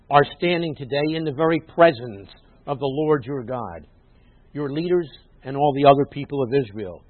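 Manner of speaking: loud, measured proclamation; declaimed scripture, leaning on key words; emphatic scripture reading. Acted. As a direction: read the verse out forcefully to the congregation. Reads scripture aloud, emphatically.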